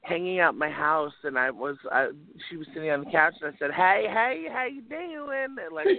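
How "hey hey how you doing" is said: high, hammed-up Southern greeting; bad Southern accent. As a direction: put on a horrible Southern accent, pitched up. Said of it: "attempt at a Southern accent".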